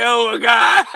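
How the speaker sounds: "Oh, my God" quoted through laughter; a laughing imitation of "Oh, my God"; laughing mock quote. Retelling the commentator's line while laughing.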